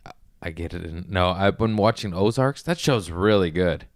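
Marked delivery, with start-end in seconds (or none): 0.1-1.1 whispered aside. Real, not acted. Muttering aside to himself.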